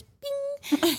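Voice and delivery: tiny high-pitched ping; imitated twinkle. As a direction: make a high cartoon sparkle noise. Imitating star twinkle in sky.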